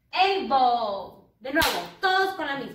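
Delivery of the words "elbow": Elbow loud with falling tune; English elbow. Shown as a demonstration. Teacher leading choral repeat of Elbow. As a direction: say Elbow for them to copy. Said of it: "repitan después de la miss".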